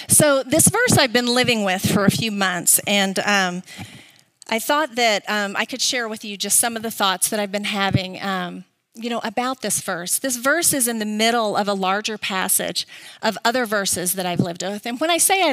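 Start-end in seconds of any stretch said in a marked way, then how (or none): none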